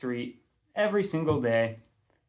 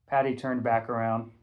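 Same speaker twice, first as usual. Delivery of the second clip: ordinary delivery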